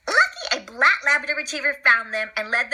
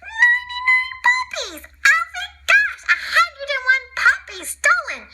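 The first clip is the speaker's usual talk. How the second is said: excited squeal. Acted. Excited high voice.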